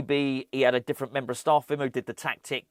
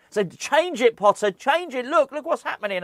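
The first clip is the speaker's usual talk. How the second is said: loud, high-pitched exasperated yelling at the screen; frantic fan's screamed plea; retold screaming; 12 semitones higher, 5 dB louder than usual. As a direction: re-enact screaming at the manager, much higher and louder. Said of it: "you're screaming".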